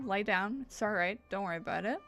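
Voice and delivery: ordinary talk, nothing marked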